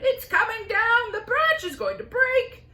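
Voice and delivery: high, loud warning shout. Acted. Aunt shouting at all to stand back.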